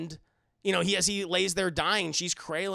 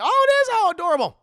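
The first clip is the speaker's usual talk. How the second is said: gushing surprise; 12 dB louder, faster than usual. Real, not acted. Learns it's Hemsworth's daughter.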